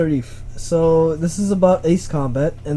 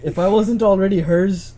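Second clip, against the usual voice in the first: normal talking voice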